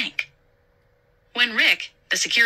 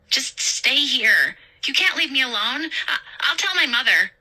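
put-on frightened clingy girl; 7 semitones higher than usual. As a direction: beg her not to leave. Angela voiced, scared.

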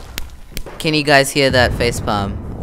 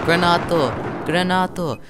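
higher-pitched mock Italian accent; put-on Italian accent, pitched up; 6 semitones higher than usual. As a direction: exclaim it like an Italian. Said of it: "I put an Italian accent on it".

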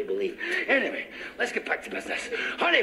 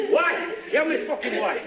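ordinary talk

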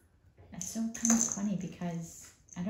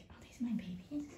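whispered mock cat voice, a bit higher; a worried mother cat, whispered; 5 semitones higher, 6 dB quieter than usual. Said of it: "Emily's like".

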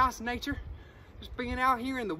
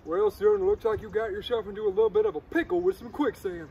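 lawman voice; 4 semitones lower, 3 dB louder than usual. Pretend. Sheriff arrives, drawling.

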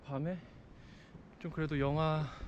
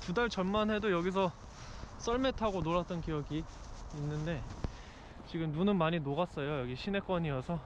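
muffled behind mask; speaking into a mask; through a face mask; 3 dB quieter than usual. Real, not acted.

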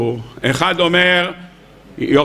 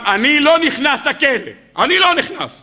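stubborn refusal; 7 semitones higher than usual. Acted. Shimon refuses prison.

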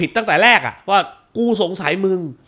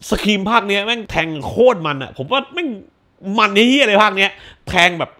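normal talking voice

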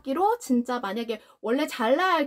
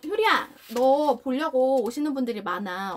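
ordinary delivery

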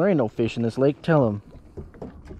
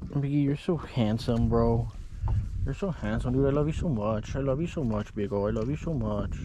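tender cooing; 6 dB quieter than usual. Real, not acted. Owner telling his dog he loves him, soft and tender.

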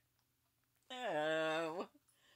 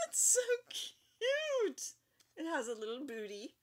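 cooing; 7 semitones higher than usual. Real, not acted. Cooing over cute creature.

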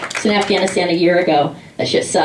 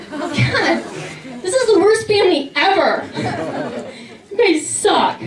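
much higher mock exasperation; 8 semitones higher, slower than usual. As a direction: whine it up high. Exasperated at family.

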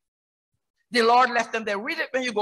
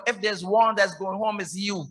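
usual speaking voice

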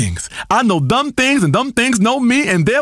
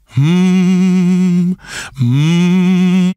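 deep hum; 7 semitones lower than usual. Acted. Guru hums on air.

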